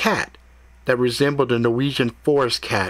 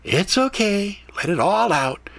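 higher, soothing cat voice; comforting cat; 10 semitones higher than usual. A narrator's reading. Cat soothing Ethan while he is sick, higher voice. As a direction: coax him gently.

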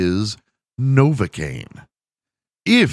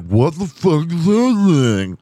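higher, slow, slurred words through a numb mouth; 9 semitones higher, 5 dB louder, slower than usual. Retold or imitated. Friend squirts anesthetic lube, tries to talk numb-mouthed. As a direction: mumble it like your mouth is full of novocaine. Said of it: "I'm like; why I'm speaking that way".

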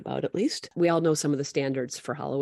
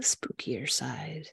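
spooky whisper; 4 semitones lower, 5 dB quieter, much slower than usual. Pretend. Spooky Halloween question set-up.

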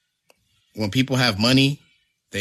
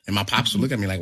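ordinary delivery

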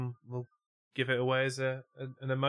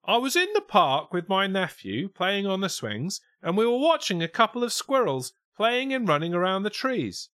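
high, loud quote in Oxford English; Oxford accent; 9 semitones higher, 6 dB louder than usual. Acted. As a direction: voice the witness as if she's from Oxford. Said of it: "She sounds like she's from Oxford".

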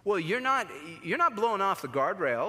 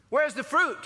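God demanding; 9 semitones higher, 5 dB louder than usual. Acted. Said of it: "he says".

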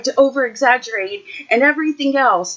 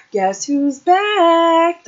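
high, drawn-out sing-song tease; sly kid voice; 8 semitones higher, 4 dB louder, much slower than usual. Retold or imitated. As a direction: stretch it out like a cheeky kid announcing himself. Nephew's greeting at the back door.

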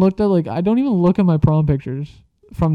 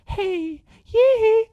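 [name] impression. Imitating the creepy dude like [name], high falsetto. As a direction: squeal up into MJ falsetto. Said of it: "like a [name]".

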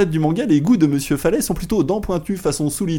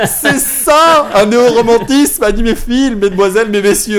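hammy grand announcement; 7 semitones higher, 7 dB louder than usual. Acted. Hammed-up grand address to audience.

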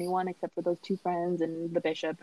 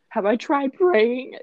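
slow, higher and louder disbelief; incredulous repeat; 6 semitones higher, 6 dB louder, much slower than usual. Retold or imitated. Teen mocking teacher's prayer advice, slow incredulous echo. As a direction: drag it out in disbelief.

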